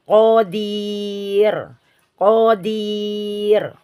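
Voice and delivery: qadir, r kept light; careful qadir; qadir thin r. Shown as a demonstration.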